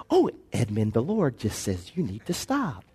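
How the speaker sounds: a meek, hushed telling-off instead of a sharp one; too-gentle rebuke. Retold or imitated. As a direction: soften the rebuke until it has no bite. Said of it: "I didn't tell you to say it like that".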